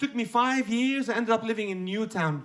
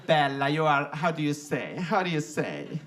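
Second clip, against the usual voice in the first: low, halting Italian English; struggling Italian accent; 7 semitones lower, 3 dB quieter, slower than usual. Retold or imitated. Italian man searching for English words, halting accent. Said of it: "The poor guy is struggling to talk".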